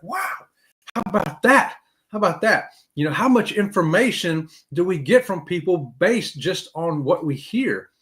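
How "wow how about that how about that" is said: amazed. Retold or imitated. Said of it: "I'm like".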